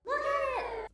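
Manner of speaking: very high, full-volume shriek; over-the-top excited shriek; screaming. Acted. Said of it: "I'm, I'm screaming".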